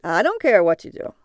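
a cabbie tossing a dismissal over his shoulder; indifferent cabbie. Acted. Cabbie shrugs off passenger.

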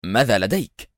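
mildly irritated question; understated nervous impatience; tense irritation. A narrator's reading. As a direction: ask with a touch of nerves. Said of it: "بدت العصبية في صوت إليعازر".

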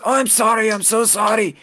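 put-on high, thin voice of an old man. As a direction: play the old kitchen man, pitched high. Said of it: "And he says".

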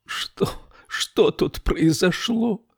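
high, slow anguished moan. A narrator's reading. Camilla seeing her friend's body, moaning. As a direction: moan it out in shock and grief. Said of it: "простонала Камилла".

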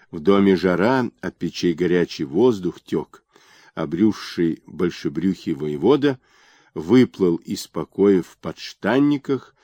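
ordinary delivery

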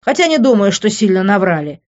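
fed-up, emphatic complaint; snorting annoyance. A narrator's reading. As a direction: grumble forcefully, with a scornful edge. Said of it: "фыркнул Лес".

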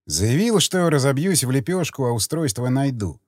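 usual speaking voice

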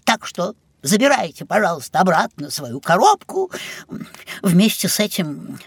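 ordinary talk

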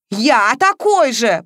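puffing with fury like a steam engine; incredulous angry outburst; fuming. A narrator's reading. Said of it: "паровозом дышал Матвеев".

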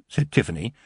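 ordinary delivery, nothing marked

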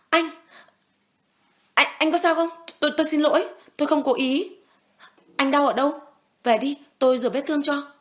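high, loud, stammering panic; panicked worry. Acted. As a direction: rush your words, scared you've hurt him. Panicked woman over hurt man.

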